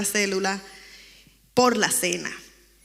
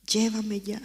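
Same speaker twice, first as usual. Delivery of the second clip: whisper; slower than usual. Retold or imitated. Begging God in despair.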